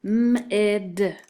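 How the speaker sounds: "M-id" with the M held apart from "id"; sounded out. Shown as a demonstration. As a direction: stretch the M, then add "id". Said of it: "First syllable".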